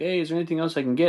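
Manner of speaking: light, high, quick waiter voice; quick upbeat service offer, pitched high; lightly voiced waiter. Waiter checking on a table, lightly perky.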